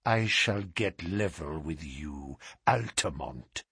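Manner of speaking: low, soft, deliberate vow of revenge; hushed, deliberate menace; slow deliberation. A narrator's reading. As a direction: measure out the threat quietly and low. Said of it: "speaking with slow deliberation".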